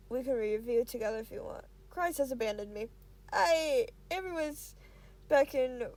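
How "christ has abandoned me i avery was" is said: ordinary delivery, nothing marked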